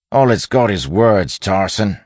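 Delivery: bandit calling out. A narrator's reading. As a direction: call roughly to the gang. Bandit reports to his leader.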